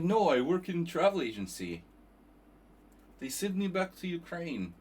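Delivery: mock Ukrainian-accented reply; imitating Novikov. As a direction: quote him in a Ukrainian accent.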